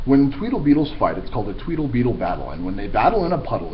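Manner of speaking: fast, bouncy patter; rapid tongue twister. Acted. Teacher reading Dr. Seuss to kids, fast patter.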